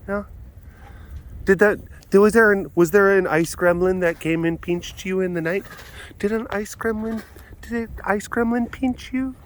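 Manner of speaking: high, mock-concerned questioning; playful pet talk. Keeper asks animal about a pinching ice gremlin, high playful pet talk.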